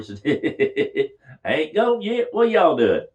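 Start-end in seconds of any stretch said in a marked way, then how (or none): 1.4-3.1 high, loud pretend shout to the mourners; mock calling out. Joker rises from his coffin at his own funeral, mock shout. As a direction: pop up and call out in mock protest.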